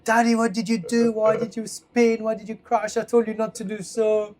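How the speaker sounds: put-on high, loud barrage of a child's accusing questions; upset child's scolding. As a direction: imitate a small son's high, pleading reproach. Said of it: "I was crying by the end. He was like".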